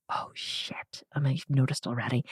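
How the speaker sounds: whispered oh shit in dread; dismayed whisper. Retold or imitated. Girl whispering in dread as dad finds the jug. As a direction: breathe it out caught. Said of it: "And I remember being like".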